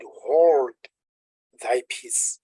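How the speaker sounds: King James wording. Shown as a demonstration. Preacher quoting the King James Bible, firm then hushed. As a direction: read it like the old Bible. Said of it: "That's the King James way of saying".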